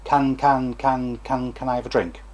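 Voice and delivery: easy, even repetitions of the first word; demonstrated relaxed stammer; disfluent child. Shown as a demonstration. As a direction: repeat the opening word steadily, without struggle. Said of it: "So you have a disfluent child".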